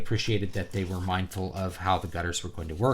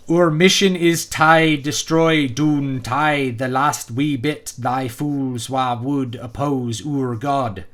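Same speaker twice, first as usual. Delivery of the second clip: slow, loud, laboured phonetic Irish brogue; Irish accent; 3 semitones higher, 5 dB louder, much slower than usual. Acted. Reviewer mocks overdone phonetic dialogue, in a thick Irish accent. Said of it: "his Irish accent is".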